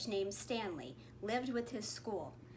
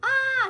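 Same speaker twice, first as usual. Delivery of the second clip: much higher, louder scream; terrified shriek; 14 semitones higher, 12 dB louder than usual. A narrator's reading. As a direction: shriek in fright. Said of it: "Stanley shrieked".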